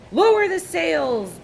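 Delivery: loud, high, slow shouted order; storm shout. A narrator's reading. Captain orders the crew in the storm, loud slow shout. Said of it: "Braidbeard shouted".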